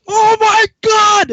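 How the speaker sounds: slow, high, very loud mock horror; outraged sergeant shout. Sergeant inside greets recruit, drawn-out outraged shout. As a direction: stretch it into a huge appalled yell.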